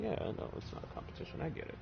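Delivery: fast, soft whispered sarcasm; whispered petty reply. Retold or imitated. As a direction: hiss it back, pretending not to care. Locals answering in a petty tone.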